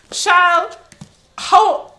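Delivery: high, loud cry of disbelief; exasperated outburst. Real, not acted. Reader exclaims at a passage she can't believe, exasperated.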